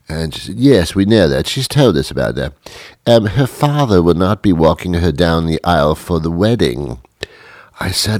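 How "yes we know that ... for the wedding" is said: brisk British press secretary; British official relaying news; British accent. Acted. Press secretary explains about the wedding, British accent. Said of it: "had a British accent".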